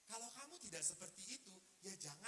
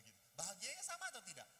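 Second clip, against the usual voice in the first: ordinary talk, nothing marked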